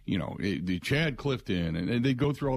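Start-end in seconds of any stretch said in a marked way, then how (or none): none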